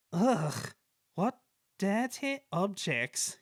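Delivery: slow, sneering and disdainful; rude disgust. Acted. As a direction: look down your nose at them, drawn out. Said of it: "James was very rude".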